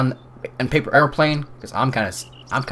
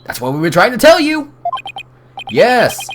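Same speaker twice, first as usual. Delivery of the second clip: loud, higher burst of frustration; exasperated; 10 semitones higher, 6 dB louder than usual. Acted. Lawyers fed up with the distracted scientist, exasperated and loud. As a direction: snap back that you already said so.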